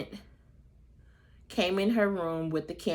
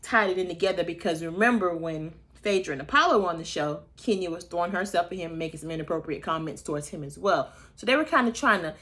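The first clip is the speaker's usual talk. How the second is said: normal talking voice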